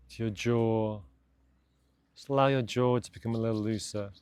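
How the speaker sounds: calm, measured meditation voice with long pauses; guided meditation. Real, not acted. Meditation teacher guiding listeners to de-stress after a busy day, calm and spacious. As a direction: ease them gently through each instruction, unhurried. Leads a body scan meditation.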